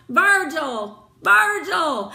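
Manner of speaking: loud calls of his name from above; calling out. A narrator's reading. Friends calling out to Virgil in the well, loud. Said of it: "calling out".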